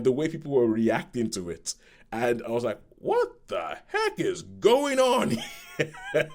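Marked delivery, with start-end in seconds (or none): 3.0-6.3 very high, drawn-out mock shock; exaggerated disbelief. Stunned viewer reacting to a shocking film scene, exaggerated disbelief. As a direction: stretch it out in high-pitched, hammed-up disbelief.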